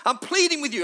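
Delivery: high, urgent plea; pleading. Retold or imitated. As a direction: beg them to listen. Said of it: "he's pleading".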